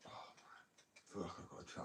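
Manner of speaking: breathy whisper, almost no voice; real whisper, talking under her breath; hushed whisper. Real, not acted. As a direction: drop to a whisper to yourself. Sudden hushed aside to herself.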